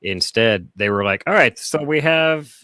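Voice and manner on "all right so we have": starting into a German voice, high and loud. Acted. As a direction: launch loudly into a mock German engineer. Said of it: "about to do German voice".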